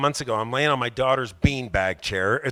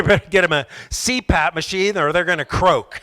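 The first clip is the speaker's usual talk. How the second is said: normal talking voice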